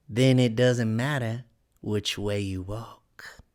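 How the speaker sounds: slow, drawn-out cat character voice; sly Cheshire Cat. A narrator's reading. Cat answering Alice's question, slow and teasing. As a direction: stretch the words out in lazy riddles.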